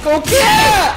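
panicked high shout; being chased. Real, not acted. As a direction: yell in panic as it hunts you.

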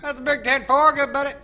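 high, loud trucker-style sign-off; mock radio reply. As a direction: play along over the radio, loudly. Answers in mock radio talk.

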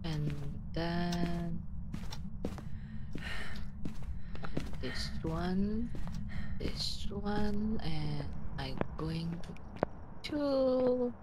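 low, soft murmur to oneself; hushed murmur. Real, not acted. Player mid ghost hunt, murmuring to himself. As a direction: think aloud softly and low, with long gaps.

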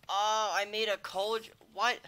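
drawn-out annoyed whine at the mistake; exasperated. Real, not acted. Streamer crafting the wrong item by accident, exasperated whine.

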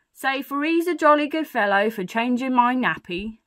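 put-on feed the baby a line to repeat; storybook burglar prompting proudly; coaching a baby. Burglar coaches baby to praise him.

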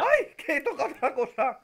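sudden high cry of alarm; yelp, caught off guard; startled yelp. Real, not acted.

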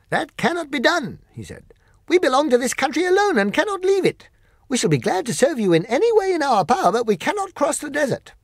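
high, loud creature voice, firm; polite but final refusal; Monkey King declining, high and loud; Monkey King refusing. Acted.

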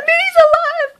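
very high, tearful squeal; overwhelmed joy. Real, not acted. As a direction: squeal it out, almost crying with happiness. Overwhelmed that friend is alive.

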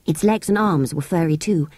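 ordinary delivery, nothing marked

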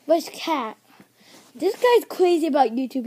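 normal talking voice

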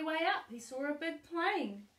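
plain reading voice

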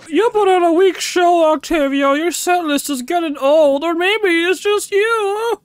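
high, loud jeering heckler voice; balcony heckler. Acted. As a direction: heckle the performer theatrically.

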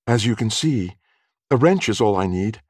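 high female customer explaining; young woman's voice. A narrator's reading. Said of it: "There was a young woman in the shop".

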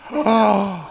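a sharp mock gasp of horror; shocked gasp. Speaker mimics the doctors' audience hearing the talk, with a horrified gasp. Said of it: "the whole audience was in horror".

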